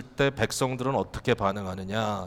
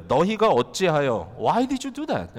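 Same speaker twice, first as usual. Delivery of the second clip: 목소리를 높이고 느리게 늘여 따지는 인용; imitating defiant protest, high and drawn out; 따지며 대들기; 4 semitones higher, much slower than usual. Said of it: "이렇게 대드는 거예요". (높고 느리게 대드는 말투)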